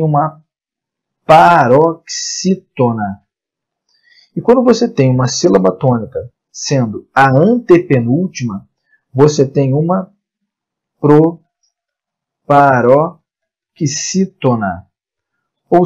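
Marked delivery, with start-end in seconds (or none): 1.2-3.2 "paroxítona" very slow, drawn out; sílaba por sílaba. Shown as a demonstration. Teacher introduces term syllable by syllable. 12.5-14.9 "proparoxítona" very slow, with pauses between pro, paró and xítona; broken into pieces. Shown as a demonstration. Teacher introduces term in pieces.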